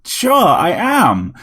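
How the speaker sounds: begging to be believed; imploring robot. A narrator's reading. Robot begging Harry to believe it is Dracula, imploring. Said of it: "it said imploringly".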